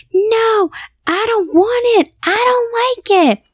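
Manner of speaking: high-pitched imitation of a screaming child; mock scream. Daughter refusing the fever patch, high mock scream. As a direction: squeal it in protest like a little girl. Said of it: "she would just scream and be like".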